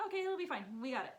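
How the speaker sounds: put-on squeaky confident kid; high kid voice. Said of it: "they were like".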